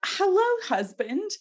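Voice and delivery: high, sugary sarcastic hello; mock sweet greeting. Bertha greets her husband with mock sweetness. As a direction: greet the husband with fake delight. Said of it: "Like, thrilled about that".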